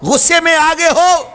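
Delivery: impassioned sermon voice; fiery preaching. Acted. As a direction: drive the command home. Preacher exhorts with rising force.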